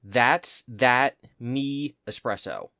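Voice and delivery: said slowly, word by word; slow recited lyric. Shown as a demonstration. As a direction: lay each word out slowly. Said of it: "the chorus of the song is".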